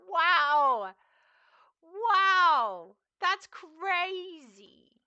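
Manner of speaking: loud, high, very drawn-out wows; mock amazement. Streamer reacting to offended commenters, hammed-up amazement. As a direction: stretch it out in exaggerated astonishment.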